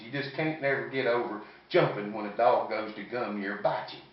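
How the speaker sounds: ordinary delivery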